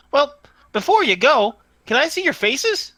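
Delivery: high-pitched little tinny voice; small tinny voice. Acted. Hidden voice in the tree asks to see faces, tinny. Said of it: "A small, tinny voice comes from the tree".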